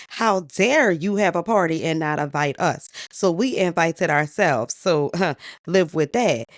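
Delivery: loud, indignant retort with a scoff; defiant comeback. Acted. Uninvited giant bird confronting the king at his party, defiant. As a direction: throw it back at them, loudly and defiantly.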